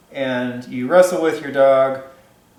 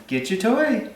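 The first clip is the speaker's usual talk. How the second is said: much higher, sing-song call to the dog; 9 semitones higher than usual. Real, not acted. Trainer calling her dog Sugar to bring her toy, high coaxing call. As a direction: coax the dog over, pitched way up.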